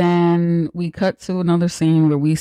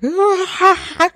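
imitated yell; 14 semitones higher than usual. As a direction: belt a cathartic pretend scream. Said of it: "like, yelling".

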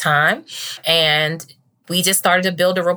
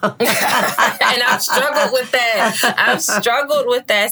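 slow, laughing, higher in pitch; 7 semitones higher, much slower than usual. Real, not acted. Laughing as the host teases her hard-to-label career. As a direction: laugh through the admission.

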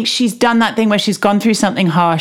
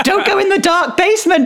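mock horror warning; 11 semitones higher than usual. Mock warning to horror movie character.

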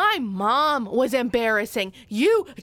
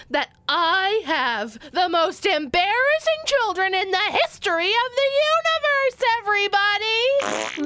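proclaiming; 7 semitones higher than usual. Acted. Mom proclaims to whole library.

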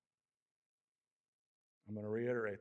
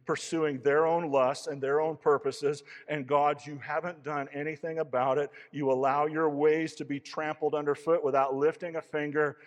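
loud, high, accusing prayer; fiery complaint; 4 semitones higher, 13 dB louder than usual. Acted. Elijah accusing God of doing nothing, loud and bitter. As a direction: hurl the grievance at God.

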